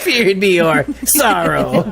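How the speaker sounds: slow eerie voice through laughter; laughing while playing a sorrow-eater; shadow creature. Spooky voice, laughing.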